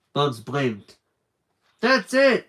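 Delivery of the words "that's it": high loud excited cry; excited shout. Acted. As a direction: burst out with the idea. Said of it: "he shouted".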